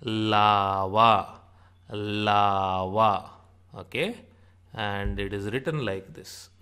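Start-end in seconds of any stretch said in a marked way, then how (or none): 0.0-3.3 "lava" slowly stretched as laaa-vaa; demonstrated long vowel. Shown as a demonstration. Teacher shows la as in lava, drawn-out "laaava". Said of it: "Or like L A in lava".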